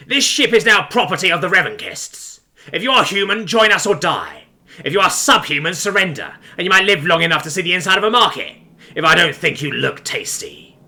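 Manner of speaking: disgusting raider captain. A narrator's reading. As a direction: declaim threats as a repulsive boarding captain. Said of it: "a disgusting but strangely familiar voice with that strange accent".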